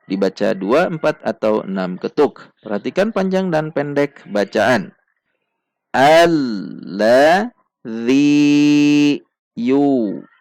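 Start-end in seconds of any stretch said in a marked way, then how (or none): 6.0-10.3 very slow melodic chanted tartil; Quran recitation. Shown as a demonstration. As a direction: chant each word with long pauses. Teacher recites verse slowly.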